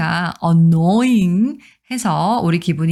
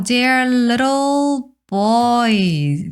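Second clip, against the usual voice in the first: put-on little boys said slowly, a bit higher; slow, slightly raised reading; slow model reading; 6 semitones higher, much slower than usual. Shown as a demonstration. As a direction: read it slowly for learners. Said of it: "slow model reading".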